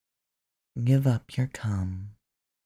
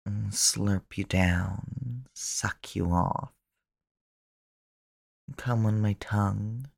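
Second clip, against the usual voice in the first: ordinary talk, nothing marked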